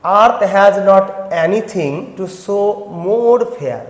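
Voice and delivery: each word drawn out very slowly; slow poem reading. Shown as a demonstration. Teacher reading the sonnet's first line to students, very slow. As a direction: recite the opening line slowly, savouring it. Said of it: "দেখো first সেন্টেন্সটা কি বলছে".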